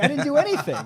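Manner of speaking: high, loud protest of innocence; mock protest. Parent protests to annoyed teenager, mock wounded innocence. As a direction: play the wronged parent, half laughing.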